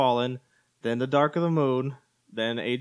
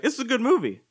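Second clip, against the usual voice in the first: convincing yourself; 9 semitones higher than usual. Retold or imitated. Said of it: "I was like".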